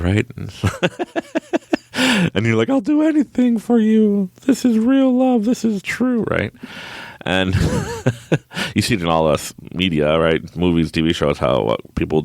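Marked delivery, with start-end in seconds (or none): 2.7-6.2 much higher mock-swooning voice, a little slow at first; mock lovestruck. Teller mocking his younger self, high swooning lovestruck voice. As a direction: ham up the starry-eyed young romantic.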